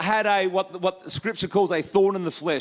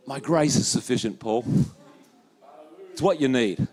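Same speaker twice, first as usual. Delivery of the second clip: much lower, weighty reveal after the drum roll; solemn voice of Jesus; 10 semitones lower than usual. Retold or imitated. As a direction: deliver the big answer gravely. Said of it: "Jesus, drum roll, up, up".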